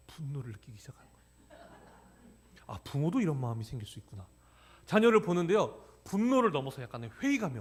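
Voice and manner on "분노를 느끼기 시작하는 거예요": whisper. Real, not acted. Confessing anger at his child.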